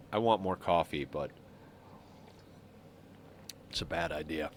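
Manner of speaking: ordinary talk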